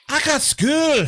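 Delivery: imitating the little Scottish girl's protest, high and loud; a kid complaining "I got school" in a thick Scottish accent. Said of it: "the meme of the little Scottish girl".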